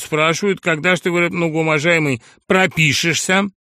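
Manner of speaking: high, loud, cocky and aggrieved; a brash lout talking back; crude upstart voice. Acted. Brash Sharikov talks back to the professor, high and loud. Sharikov explains the housing committee.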